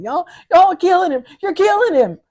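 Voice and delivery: high, fast frantic cry of accusation; frantic accusing mother. Retold or imitated.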